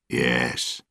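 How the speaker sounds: subdued, downcast answer; sad. A narrator's reading. Prisoner Gibbs confirms bad news to Will, sadly. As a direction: answer low-key, with a touch of sadness. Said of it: "Gibbs said sadly".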